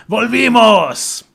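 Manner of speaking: loud, high, drawn-out yell; triumphant taunting shout. Shown as a demonstration. Shouted at a rich Argentine to trigger them, loud taunting yell. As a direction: bellow it with long stretched vowels. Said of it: "You say, "Volvimos," like".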